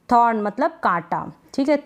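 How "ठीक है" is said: ordinary talk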